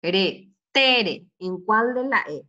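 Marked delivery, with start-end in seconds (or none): 0.0-1.3 "Pretere" with a loud TE after a short break; stress on middle syllable. Shown as a demonstration. Teacher quizzing pupils on the stressed E, "Pretere" with strong TE. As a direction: hit the TE in "Pretere". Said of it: "en la del medio".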